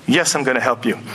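low, subdued imitation of an agent. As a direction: placate the excited client in a low voice. Retold agent answers excited client.

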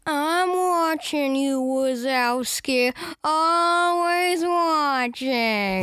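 drawn-out, very slow put-on movie voice; movie character imitation. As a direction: stretch each word out, watchful and creepy. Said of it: "What's that from?".

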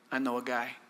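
low, quiet Italian wise-guy voice; Italian accent. Acted. Cupbearer tipping off Pharaoh in a low Italian voice. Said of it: "I said it in an Italian voice".

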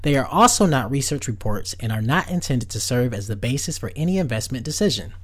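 loud, rushed disclaimer read; fast legal disclaimer. Acted. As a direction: rattle off the fine print.

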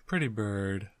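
soft, strange cooing at a pet. Acted. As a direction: coo it like talking to a parrot. Player coos while petting someone's head.

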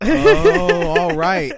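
high, loud drawn-out "oh"; exaggerated, loud dawning surprise; sudden realization. Retelling their own discovery of the bumps, exaggerated realization.

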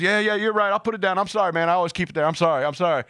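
high-pitched imitated backing down; apologetic driver. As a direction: apologize repeatedly, pitch gradually settling. Acts out pistol owner apologizing.

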